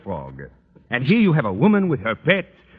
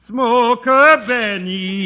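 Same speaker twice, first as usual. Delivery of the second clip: slow, high, drawn-out sing-song ad pitch; radio ad slogan; 10 semitones higher, 4 dB louder, much slower than usual. Retold or imitated. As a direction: mimic a radio commercial slogan, stretched out. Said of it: "What is it you hear on the radio all the time?".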